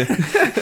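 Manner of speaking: a short, high, bright laugh; burst of laughter. Real, not acted. Laughing to the interviewer about a harsh review. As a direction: laugh it off with a quick giggle.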